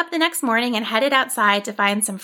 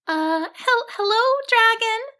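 high, stammering little sheep; timid high voice, halting; nervous wobble; 13 semitones higher than usual. A narrator's reading. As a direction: stumble nervously over the greeting. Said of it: "managed to nervously wobble out".

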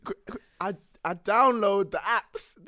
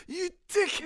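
much higher mock shout; insult yell; 12 semitones higher than usual. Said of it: "I'm just in the back seat like".

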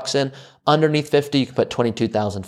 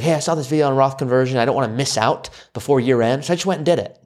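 usual speaking voice

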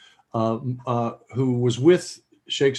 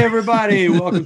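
higher, louder hello; bright retake greeting; 8 semitones higher, 5 dB louder, faster than usual. Shown as a demonstration. Host redoing the greeting for listeners, louder and higher. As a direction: greet them with more energy. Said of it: "let's try it again".

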